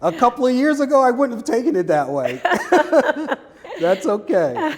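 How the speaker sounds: high laugh through the words. Real, not acted. Laughing along with the host.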